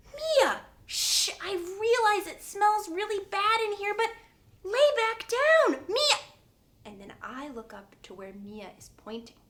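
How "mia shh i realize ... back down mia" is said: loud, high-pitched exasperated teacher; frantic scolding. Acted. Teacher to screaming Mia at rest time, frantic scolding. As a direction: call her name and order her down, urgently.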